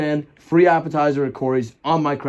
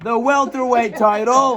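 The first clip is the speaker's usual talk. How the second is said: slow, higher announcer call; ring announcer voice; 7 semitones higher, 3 dB louder, slower than usual. Pretend. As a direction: boom it out to the arena. Mock boxing ring introduction.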